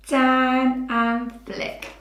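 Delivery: slow, drawn out. Shown as a demonstration. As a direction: narrate the stroke slowly for a child. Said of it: "Let's do it together".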